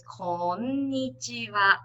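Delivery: "konnichiwa" slow with equal-length syllables; even slow syllables. Shown as a demonstration. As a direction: give each syllable of "konnichiwa" the same length. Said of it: "each syllable is equally".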